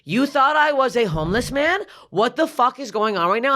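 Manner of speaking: high-pitched outraged questioning; indignant disbelief. Retold or imitated. Shopper mistaken for homeless man, high indignant protest.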